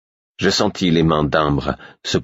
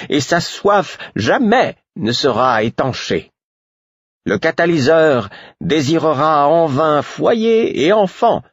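put-on much higher, slow prophetic old woman's chant; blissful satisfaction; 12 semitones higher, slower than usual. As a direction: recite the prophecy with serene delight. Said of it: "d'un ton empreint d'une satisfaction béate".

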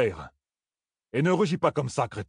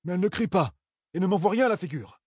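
defensive; 7 semitones higher than usual. Acted. Perrine defends his wife.

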